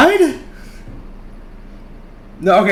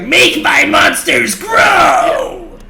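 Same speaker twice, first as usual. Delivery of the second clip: slow, drawn-out villain shout, higher and louder; 8 semitones higher, 4 dB louder, much slower than usual. Acted. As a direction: bellow it like a cartoon villain.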